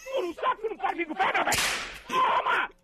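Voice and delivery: high yelling with each lash; comic rage at the feverish worker; hammed-up fury. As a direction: whip him while shouting toma. Severino lashing a feverish worker.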